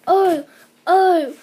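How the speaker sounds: wife sobbing over her wings. A narrator's reading. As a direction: sob each oh out. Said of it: "sobbed Missus Gregg".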